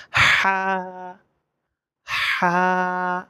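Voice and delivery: "হা" with strong friction from mid-throat, held long; mid-throat sound. Shown as a demonstration. Teacher shows where the letter comes from, throaty drawn-out "হা". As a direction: breathe a long "হা" out of the middle of the throat. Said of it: "কণ্ঠনালী মাঝখান থেকে".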